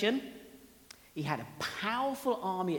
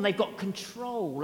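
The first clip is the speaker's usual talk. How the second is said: normal talking voice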